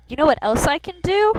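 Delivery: put-on high-pitched little boy, rising at the end. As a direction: speak up high like a small boy. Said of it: "Still keeping my little boy voice".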